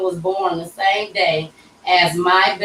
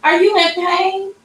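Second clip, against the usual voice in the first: high-pitched imitation of alarmed nurses; concerned nurses; 12 semitones higher than usual. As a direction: mimic nurses checking on a patient, voice lifted high. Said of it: "their asses came in".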